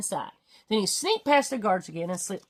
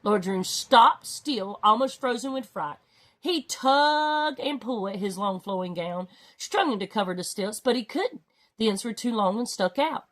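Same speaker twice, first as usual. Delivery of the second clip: plain reading voice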